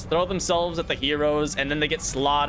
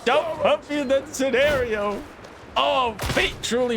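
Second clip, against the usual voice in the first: mock pleading; 9 semitones higher than usual. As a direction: beg the heavens like a hammy hero. Mock pleading about waifus.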